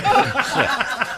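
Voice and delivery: high burst of laughter; laughing. Real, not acted. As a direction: laugh at the joke. Laugh after punchline.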